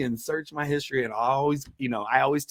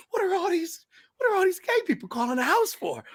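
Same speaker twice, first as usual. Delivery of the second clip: baffled dad. Retold or imitated. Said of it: "he's like".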